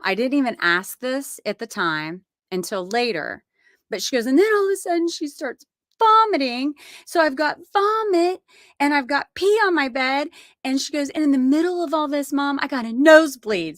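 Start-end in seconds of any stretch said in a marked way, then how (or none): 4.3-13.8 much higher, louder, quick; frazzled daughter. Retold or imitated. Tori telling mom about vomit and pee, higher and frazzled. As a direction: pile it on like an overwhelmed daughter. Daughter listing disasters on phone.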